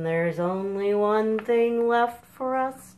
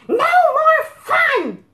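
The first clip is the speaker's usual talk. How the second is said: very high, loud slow outburst; furious shout; 11 dB louder than usual. Acted. Chicken Sofa shouts at the cows to stop. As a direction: yell it in total exasperation.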